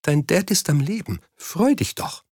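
high, thin, choked-up comforting. A narrator's reading. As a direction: comfort him while fighting back tears. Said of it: "mit erstickter Stimme".

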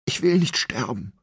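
high, whimpering plea not to die; wailing. A narrator's reading. Condemned Shen wailing to Hong, high and whimpering. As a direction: wail it out like a doomed man. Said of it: "jammerte er".

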